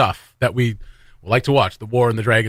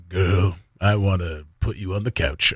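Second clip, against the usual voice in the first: low, slow crooner's seduction talk; slow, low come-on between verses; R&B spoken interlude; 6 semitones lower, 4 dB quieter than usual.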